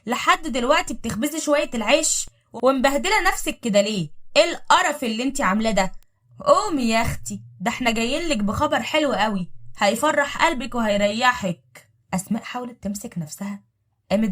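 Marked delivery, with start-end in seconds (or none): 0.0-11.9 higher and louder, taunting her sister-in-law; loud mocking voice. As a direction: call out loud and scornful, slowing on the last line. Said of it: "قالت بصوت عالي".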